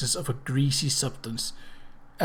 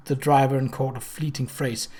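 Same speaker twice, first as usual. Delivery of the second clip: plain reading voice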